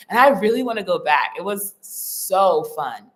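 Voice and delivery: ordinary talk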